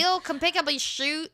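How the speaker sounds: high-pitched Minnesota parent calling; playful stab at a Minnesota accent; imitated Minnesota accent. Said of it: "a Minnesota accent".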